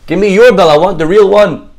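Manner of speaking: loud, high mock outrage; acted angry demand. Poor man demands rich man's bell, indignant.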